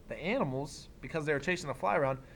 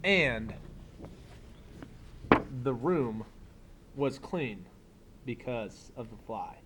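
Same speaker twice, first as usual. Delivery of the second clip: put-on reading to children; 2 semitones lower, 4 dB quieter than usual. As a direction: read it like story time for a class. Reads picture book aloud.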